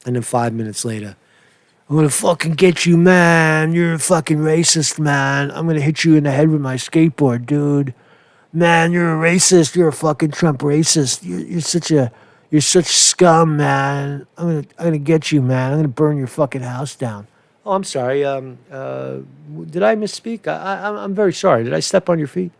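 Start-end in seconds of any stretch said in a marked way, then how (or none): 1.9-17.3 hammed-up angry rioter impression; mock threatening rant. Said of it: "That's how they are, two-faced". 17.7-22.5 fake-apologetic. Imitating the two-faced rioter suddenly apologizing to his target, fake meek politeness. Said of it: "That's how they are, two-faced".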